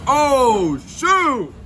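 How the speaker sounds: surprised. Real, not acted. First bite reaction, loud.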